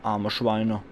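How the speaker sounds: ordinary talk, nothing marked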